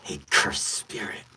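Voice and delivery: low, breathy villain getting back up; hurt villain, whispery; shaky injured villain. Acted. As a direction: sound like you just got knocked out. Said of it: "kind of shaky".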